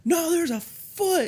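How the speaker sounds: high, slow mock-panicked protest. Comic heroine insisting there is a foot, in mock panic. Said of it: "So she starts panicking".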